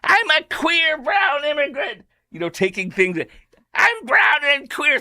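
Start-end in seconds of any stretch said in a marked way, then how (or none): 0.0-2.0 high, slow hammed-up shout; mock battle cry. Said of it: "Can you imagine this guy fighting right now". 3.7-5.0 high, slow pretend combat yell; hammed-up war cry; mock battle cry. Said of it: "this guy fighting right now in Iran".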